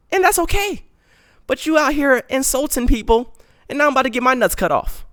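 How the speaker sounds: whiny high-pitched complaint at mom, fast at the end; panicked high-voiced rant at his mom. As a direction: squeak out the grievance like a panicked kid.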